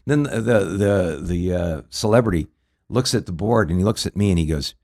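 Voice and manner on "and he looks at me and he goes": normal talking voice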